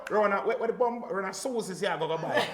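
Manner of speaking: excited mock retelling; hyped retelling. Man voiced errand for girl outside.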